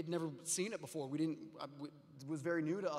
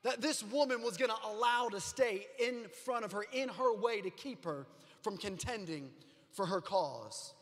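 loud, high, rising sermon cadence; full-force preaching; 6 semitones higher, 4 dB louder than usual. Acted. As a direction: preach it to the back row with fire. Pastor preaching to congregation.

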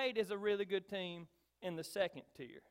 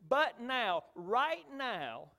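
now punched louder and higher; emphatic; 4 semitones higher, 5 dB louder, much slower than usual. Shown as a demonstration. Preacher echoing Paul's vigor to the congregation, emphatic. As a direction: drive home right now with vigor. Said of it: "He says it with emphasis and vigor and excitement".

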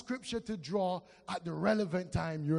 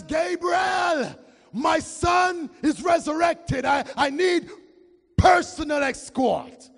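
higher and louder, slow; commanding voice of God; 10 semitones higher, 9 dB louder, slower than usual. Acted. God ordering Gabriel to escort his son, commanding. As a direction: give the order like a king.

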